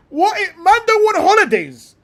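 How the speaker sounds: high, loud, disbelieving outcry; incredulous. Real, not acted. Streamer reacting to players skipping holidays, incredulous shout. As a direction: shout it like you can't believe it.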